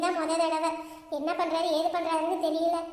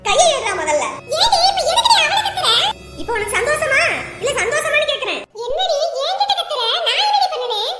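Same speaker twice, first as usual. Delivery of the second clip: louder, snapping at her family; angry outburst; 8 semitones higher, 8 dB louder than usual. Acted. Daughter rounding on her mother and brother, loud and angry. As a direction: lash out at them.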